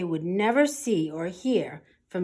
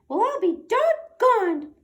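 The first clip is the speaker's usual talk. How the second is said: high, loud squeaky amazement; officer marvelling at his success, squeaky; squeaky mouse; 8 dB louder than usual. Acted. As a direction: squeak it in happy disbelief. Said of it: "squeaked the Big Cheese".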